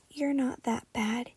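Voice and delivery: soft, shy murmur; mumbled half to himself. A narrator's reading. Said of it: "he murmured".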